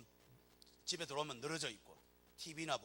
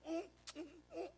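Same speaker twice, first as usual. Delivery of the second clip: grudging grunts; 11 semitones higher, 7 dB quieter, slower than usual. Acted. Grudging mother grunting while working.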